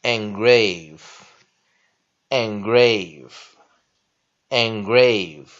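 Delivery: "engrave" said three times very slowly, starting "en"; slow pronunciation drill. Shown as a demonstration. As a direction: stretch out each "engrave" with a clear "en" start. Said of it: "第一个单词我们来先看".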